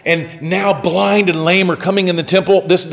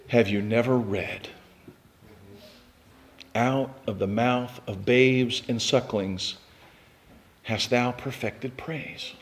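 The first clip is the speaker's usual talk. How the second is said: low, soft, slow gentle rebuke; lightly voiced Jesus; 7 semitones lower, 9 dB quieter, slower than usual. Preacher gives Jesus' answer to the Pharisees, low and slow. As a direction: let it land quietly and slowly. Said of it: "Jesus replies this way".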